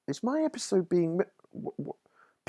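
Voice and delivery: sudden high, hushed, panicked thought; panicky thought. Retold or imitated. Writer realising his episode is being made, panicky and hushed. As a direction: replay the dawning panic under your breath.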